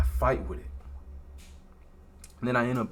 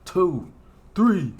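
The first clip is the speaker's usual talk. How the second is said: ref counting, getting louder; referee count; 4 semitones higher, 9 dB louder, much slower than usual. Retold or imitated. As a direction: count it out like a boxing ref, building. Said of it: "I just hear the ref".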